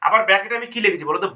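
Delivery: usual speaking voice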